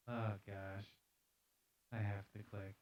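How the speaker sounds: ordinary talk, nothing marked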